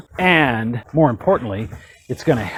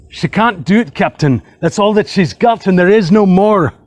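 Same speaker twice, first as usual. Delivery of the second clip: higher, strained Scottish engineer's plea; hammed-up Scotty begging the captain, Scottish-Irish muddle; amateur Scotty accent, pitched up and pleading; 5 semitones higher, 4 dB louder than usual. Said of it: "my best, uh, Scotty accent from Star Trek".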